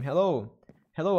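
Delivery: ordinary delivery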